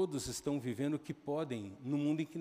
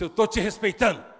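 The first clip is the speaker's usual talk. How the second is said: acted heated retort; 6 semitones higher, 12 dB louder than usual. Son answering father in quarrel.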